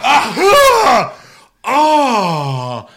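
mocking dramatic sneezers to friends; dramatic sneezes. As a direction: ham up a big sneeze fit. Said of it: "dramatic assholes that they go".

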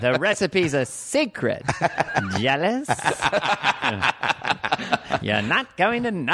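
colonel voice. Pretend. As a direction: gloat over the secret recipe. Colonel teasing the secret.

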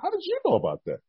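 astonished. Retold or imitated. Stunned reaction.

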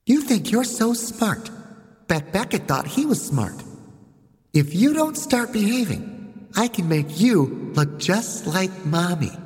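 put-on high, slow little-girl threat; menacing child. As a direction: warn the general like a spoiled tyrant. Voices child Chelsea.

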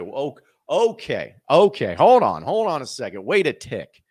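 mock fan realising big news; excited double take. Fan reacts to the tweet with excited disbelief. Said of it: "because it's like".

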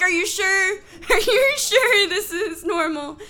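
high, loud disbelief with a laugh; incredulous questioning. Retold or imitated. New mother questioning the doctor, incredulous. Doubting the doctor's reassurance, retold.

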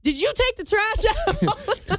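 high, drawn-out demanding question; regular Ann. Shown as a demonstration. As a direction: nag him about a chore, loose and high-pitched. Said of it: "regular Ann, I'd be like".

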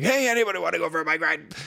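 fast, high, wired-up call; hyper bike ride call. Retold or imitated. Wife mimics husband barging in with too much energy, fast and wired. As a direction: burst in with sixty mile an hour energy. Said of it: "like, sixty mile an hour energy".

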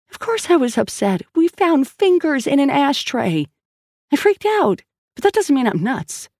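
high-pitched, agitated protest; worked-up woman insisting she is sane; upset and defensive. Acted. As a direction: defend yourself with pitch shooting up. Sal upset, defends herself.

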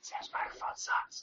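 unvoiced conspiratorial whisper. Real, not acted. Podcast host whispering a jab at Sam's microphone. Jokey aside about guest's mic.

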